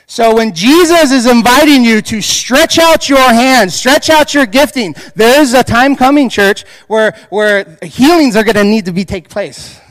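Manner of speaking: loud, high preaching cry; rally the church with a loud call; full-force preaching. Real, not acted. Pastor exhorting the church, loud and high.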